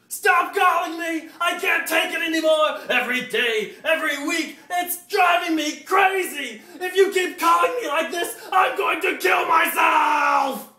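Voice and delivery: high, loud, slow screaming rant at the caller; hammed-up frantic shout. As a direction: scream down the phone like you're losing it. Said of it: "and I said".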